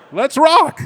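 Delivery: hyped to take the pain; fired-up let's rock. Retold or imitated. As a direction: shout it like a rallying cry. Repeated with gusto.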